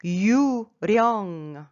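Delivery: 유령 drawn out slowly with a rolled R starting 령; slow, loud teaching model of 유령. Shown as a demonstration. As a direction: roll the R in 유령, syllable by syllable. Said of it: "il faut rouler".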